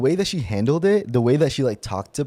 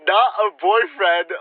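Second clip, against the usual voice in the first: higher voice building to a shout; dramatic reveal; 11 semitones higher, 3 dB louder than usual. Real, not acted. Telling co-host the crush is taken, shouted reveal. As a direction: build to a loud, high outburst on the last word.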